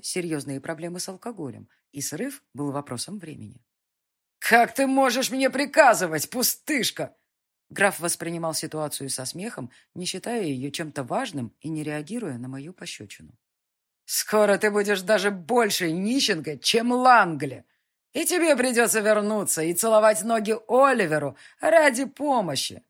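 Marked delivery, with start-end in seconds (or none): 4.4-7.1 put-on loud, high drunken scoff; mock her like a drunk lord; drunken mockery. Drunk count mocks heroine. 14.0-22.8 put-on loud, high, slowed drunken taunt. Drunk count taunts heroine.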